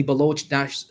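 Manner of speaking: ordinary delivery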